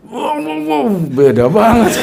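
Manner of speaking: very high loud slow wow with a laugh; laughing astonished wow; amazed wow. Real, not acted. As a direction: gasp out your amazement. Amazed at the second jinn.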